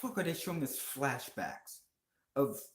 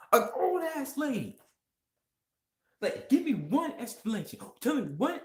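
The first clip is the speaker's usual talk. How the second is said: much higher and louder, incredulous; complaining to fanboys, high pitched and indignant; outraged rant; 12 semitones higher, 4 dB louder than usual. Real, not acted. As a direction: squeal it out in outrage. Outraged at gender swap.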